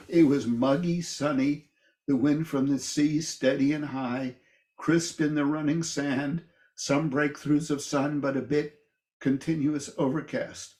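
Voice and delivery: poem reading. Acted. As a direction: lay out each image of the verse clearly, like reading to a class. Reads poem lines aloud.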